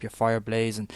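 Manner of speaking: ordinary delivery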